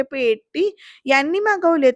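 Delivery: slow, drawn-out questioning swoop; puzzled wondering. Retold or imitated. Dhanashree wonders who ordered the box, puzzled drawl. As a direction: stretch the first word in doubt. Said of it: "Dhanashree विचारात पडते आणि म्हणते".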